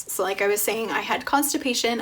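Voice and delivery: ordinary delivery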